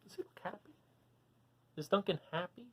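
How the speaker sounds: ordinary delivery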